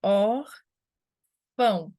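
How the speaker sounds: "Orfão" very slowly, a loud OR, pause, then fão; split into syllables. Shown as a demonstration. Teacher showing the strong syllable in "Orfão", stressed OR, long pause. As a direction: break "Orfão" in two, stressing the first syllable. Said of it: "A gente tem or".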